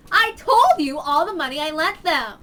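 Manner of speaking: loud, exasperated retort; yelling back. Acted. Ritsuko yelling back at the man, loud. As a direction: shout right back at him. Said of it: "yelled at each other".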